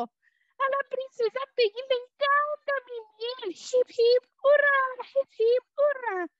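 very high, loud cheering bee. A narrator's reading. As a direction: squeak it out like a tiny bee.

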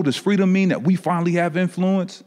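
ordinary talk, nothing marked

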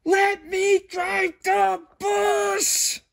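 very high, slow drawn-out shout; stretched-out furious pigeon yell; pigeon tantrum. Acted. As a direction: scream it as a full tantrum. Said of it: "Ooh, he's really mad, huh?".

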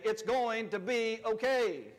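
slow, firm church declaration; confident churchy affirmation; like you believe it. Shown as a demonstration. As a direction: proclaim it like you mean it. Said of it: "like you're at church and you believe it".

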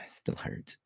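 unvoiced hurting whisper; pained whisper. Real, not acted. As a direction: whisper it through the pain. After bump on head.

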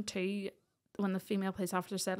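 ordinary delivery

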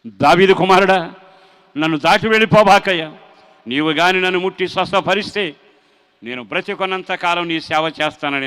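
loud anguished pleading to God; crying out in prayer. Retold or imitated. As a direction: cry out for mercy with everything. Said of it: "బాధగా ఏడుస్తూ". (బాధతో గట్టిగా మొరపెట్టే ప్రార్థన)

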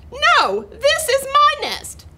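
high, loud protesting hen; indignant chicken. A narrator's reading. Chicken to duck over the nest, loud indignant cry. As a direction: squawk the claim at the rivals.

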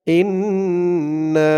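"ইন্না" chanted slowly with a long nasal hum on the n; "ইন্না" with drawn-out humming n and long vowel; ghunnah. Shown as a demonstration. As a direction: hold the n of "ইন্না" through the nose. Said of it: "এখানে গুন্না হবে".

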